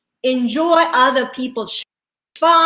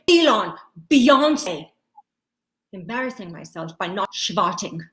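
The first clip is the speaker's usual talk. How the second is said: ordinary talk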